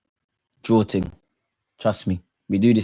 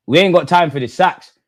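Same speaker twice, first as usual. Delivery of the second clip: usual speaking voice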